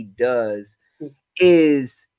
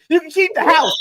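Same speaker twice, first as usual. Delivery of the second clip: high-pitched comic panic; mock scared; 14 semitones higher, 4 dB louder than usual. Husband fleeing a mouse, imitated high panic. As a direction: bail out in a hammed-up fright. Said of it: "he's so scared".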